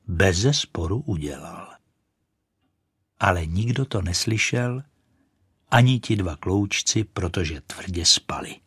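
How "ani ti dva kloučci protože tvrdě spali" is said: plain reading voice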